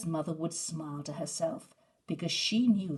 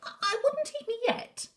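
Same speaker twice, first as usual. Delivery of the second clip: much higher, nervous stammer on I, I; 5 dB louder than usual. Acted. Herb frightened of the wolves, high nervous stammer. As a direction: stutter it out scared. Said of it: "stammered Herb".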